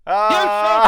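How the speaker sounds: sudden loud yelp of dismay; loud cry. Real, not acted. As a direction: let out a loud pained cry. Loud burst at a bad shot.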